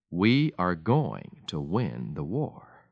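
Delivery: very slow, unhurried and sure; calm and certain. Acted. Churchill to Hitler while dipping water, slow and calm. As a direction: let each word land slowly, in no hurry at all. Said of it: "calmly dipped his teaspoon".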